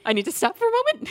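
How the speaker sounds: very high, strained squeal; mock overwhelmed. Viewer pausing an emotional movie, high mock-tearful squeal. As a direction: squeak it out as if too moved to go on. Viewer overcome, needing a break.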